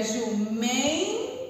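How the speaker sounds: "jumem" drawn out very slowly, rising at the end; stretched syllables. Shown as a demonstration. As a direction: stretch "jumem" out like a teacher sounding it. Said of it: "uma outra letrinha que estica a palavra".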